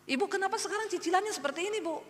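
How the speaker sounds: high, fast complaining voice; quick, annoyed demand for the money; irritated landlord. Retold or imitated. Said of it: "Pak Hajinya marah-marah".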